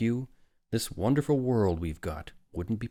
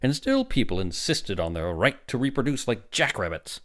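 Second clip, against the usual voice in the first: raised, swooping oratory; impassioned lecturing; 5 semitones higher, 5 dB louder than usual. A narrator's reading. Doctor recalls overpopulated past to father, impassioned. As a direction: build indignantly, louder and higher.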